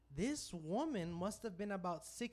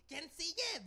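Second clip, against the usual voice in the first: a lightly voiced woman asking who's next, higher; Spanish, pitched up, offering; mock prostitute voice; 10 semitones higher than usual. The other prostitute asks who's next, in a light mock voice. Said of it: "And the other one".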